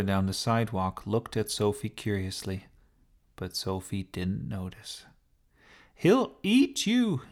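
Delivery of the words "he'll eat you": slow, high, loud warning; alarmed shout. Acted. Sophie shouting to the sparrow as Darcy crouches, slow high yell. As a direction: draw out the warning at full voice. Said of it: "she shouted".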